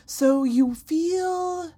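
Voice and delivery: very slow, high, drawn-out fortune teller; mystic psychic voice. Acted. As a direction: lure them in like a stage psychic. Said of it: "but it's more like".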